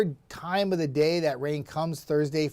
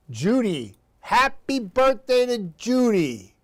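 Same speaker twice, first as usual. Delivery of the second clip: loud, high, drawn-out birthday greeting; birthday shout-out; 7 semitones higher, 4 dB louder, slower than usual. Real, not acted. Host hails a viewer on her birthday, loud and celebratory. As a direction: call out a big slow happy birthday.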